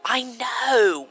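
drawn-out Australian KNOW; playful Australian mimicry; imitated Aussie accent. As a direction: copy an Aussie friend with a rounded, long "know". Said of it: "say it the way she did".

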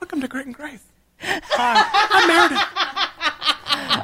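high, slow, laughing host voice; chirpy host welcome, drawn out; Meredith voice. Retold or imitated. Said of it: "That's my Meredith voice".

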